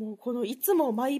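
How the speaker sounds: ordinary talk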